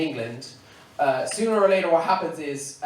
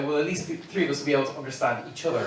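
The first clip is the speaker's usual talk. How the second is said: ordinary delivery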